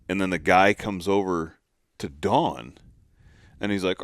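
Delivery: ordinary delivery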